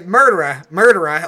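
"murderer" said the British way, R's dropped, loud; British pronunciation. Shown as a demonstration. Imitating an actor who slips into British on "murderer", non-rhotic ending. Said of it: "like, British and say murderer".

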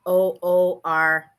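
slow, letter by letter; spelling it out. Shown as a demonstration. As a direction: spell each letter out slowly. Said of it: "Do you want me to spell it for you?".

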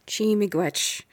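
"Chi miigwech" spoken gently as a native phrase; Ojibwe phrase. Acted. Wife saying thanks in her language, softly. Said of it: "Your language is beautiful".

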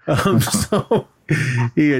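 ordinary talk, nothing marked

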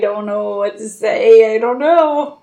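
slow, loud attempt at Australian; Australian accent. Shown as a demonstration. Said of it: "Say anything in Australian".

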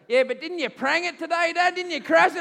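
put-on high, loud kid taunting Dad; a loud high child teasing Dad about the crash; cheeky child voice. My son goes.

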